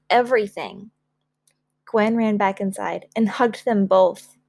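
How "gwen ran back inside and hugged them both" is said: ordinary delivery, nothing marked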